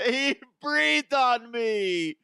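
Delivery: put-on high, slow, babyish whine; whiny LeBron. Mocking LeBron complaining to the ref, high babyish whine. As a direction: whimper it like a wounded child.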